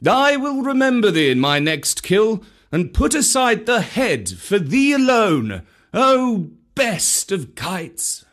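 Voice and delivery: lightly voiced proud panther; grateful panther. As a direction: promise the kite a reward. Bagheera thanks the kite.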